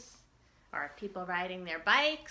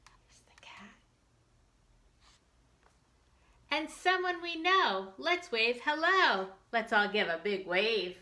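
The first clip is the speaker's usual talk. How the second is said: put-on reading to children; 3 semitones higher than usual. Teacher reads picture book to children.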